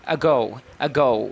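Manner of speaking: ago as "uh-GOH", repeated separately; British model pronunciation. Shown as a demonstration. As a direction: say ago twice for a learner, schwa first. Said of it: "British English".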